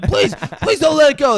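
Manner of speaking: loud high begging; mock pleading. Retelling viewers begging Elliot, loud mock plea. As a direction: plead desperately.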